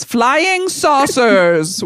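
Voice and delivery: high, very slow, stretched-out headline; drawn-out announcement. Acted. Reading the magazine's back cover aloud, slow drawn-out announcement. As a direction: announce it grandly, dragging out each word.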